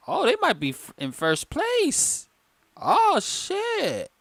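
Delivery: very high, stretched-out astonishment; squeaky-high impressed disbelief; amazed outburst. Real, not acted. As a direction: discover it with a soaring, drawn-out voice. Surprised by the team's standings.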